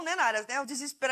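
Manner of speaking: ordinary talk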